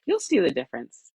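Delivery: usual speaking voice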